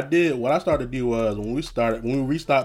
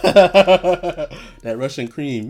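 laughing; 3 semitones higher, 7 dB louder, much slower than usual. Real, not acted. Laughing at backwood customers.

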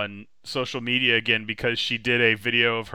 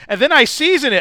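bright, high and loud recipe line; cheery cook; 11 semitones higher, 14 dB louder than usual. Retold or imitated. TikTok cook explaining her recipe, loud chirpy mock. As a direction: chirp it like a proud home cook. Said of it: "she goes".

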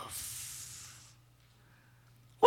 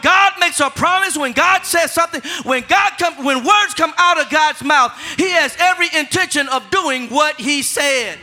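raised, louder preaching voice; forceful preaching; 7 semitones higher, 5 dB louder than usual. Acted. Pastor preaching to his church, raised and forceful.

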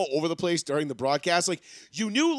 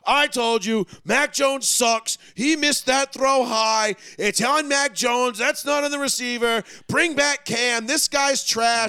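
slow, loud, higher mock rant; slow, hammed-up rage of a fed-up Patriots fan; mocking angry fan; 5 semitones higher, 4 dB louder, slower than usual. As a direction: ham up an outraged fan's tweet.

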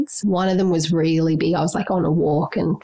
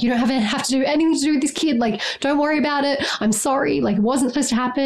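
higher-pitched, hurried retold reassurance; rushed apologetic reassurance; 7 semitones higher, faster than usual. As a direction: reenact blurting out an apology, quick and high. Blurts reassurance and apology to partner.

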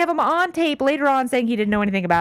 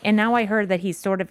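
ordinary talk

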